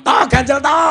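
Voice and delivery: very loud, high yell; angel's shout. Acted. Exasperated angel yells at the village head, high hammed-up shout. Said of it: "malaikate bengok".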